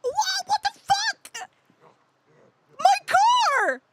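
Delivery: high, loud, slow stunned cry; drawn-out shocked yell; outraged disbelief. Real, not acted. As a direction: wail at the wrecked car. Car found wrecked.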